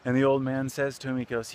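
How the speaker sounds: usual speaking voice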